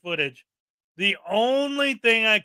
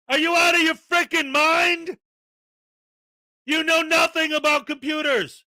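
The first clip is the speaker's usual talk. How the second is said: exasperated outburst; 6 semitones higher than usual. Real, not acted. Berating frauditors, high and angry. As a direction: blow up at them.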